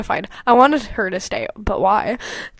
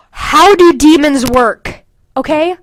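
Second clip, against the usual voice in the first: exasperated; 3 semitones higher, 7 dB louder, slower than usual. Real, not acted. Exasperated rant.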